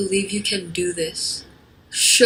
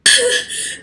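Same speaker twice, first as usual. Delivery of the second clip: mean laugh. Acted. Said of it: "She laughed and she said".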